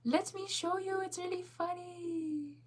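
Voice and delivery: very high, drawn-out squeaky plea; put-on squeaky excitement; playful squeal. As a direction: push it way up high and stretch the last words. Squealed, drawn-out plea to show viewers.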